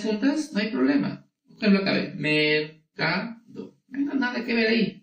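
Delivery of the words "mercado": "mercado" very slowly as mer, ca, do; syllable by syllable. Shown as a demonstration. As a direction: break "mercado" into three drawn-out syllables. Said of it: "Simplemente se separa".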